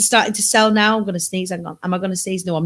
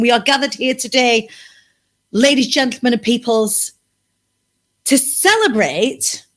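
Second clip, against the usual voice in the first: mock officiant; 2 semitones higher than usual. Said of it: "mock wedding officiant opening".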